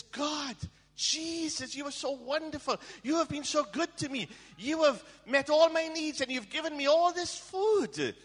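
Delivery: high, loud cry of thanks; excited shout. Retold or imitated. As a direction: shout the praise high and thrilled. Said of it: "she was so excited".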